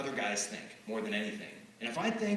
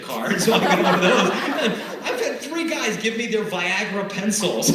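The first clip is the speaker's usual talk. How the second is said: louder, laughing through the words; real laughter breaking into the line; 5 semitones higher, 11 dB louder than usual. Real, not acted. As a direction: crack up while telling it. Crowd laughing, speaker cracks up.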